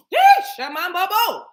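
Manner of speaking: high, loud yelp; spirit exclamation. Real, not acted. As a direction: burst out with the sacred syllables. Sudden spirit exclamation mid talk.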